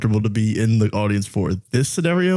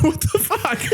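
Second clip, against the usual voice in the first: shocked reaction; slower than usual. Retold or imitated. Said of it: "I'm like".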